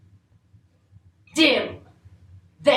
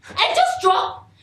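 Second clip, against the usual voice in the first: louder with each repeat, higher; rising emphatic; 8 semitones higher, 4 dB louder than usual. Acted. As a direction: build it to a half-sung shout.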